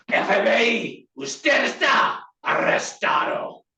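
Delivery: high, shouted Spanish command; arrest command. Shown as a demonstration. FBI trainee arresting someone in Spanish, shouted command.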